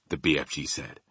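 ordinary delivery

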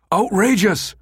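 high, indignant outburst; bellowed. A narrator's reading. Numataka cheated by the American on the phone, bellowing. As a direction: bellow it in outrage. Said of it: "Numataka bellowed".

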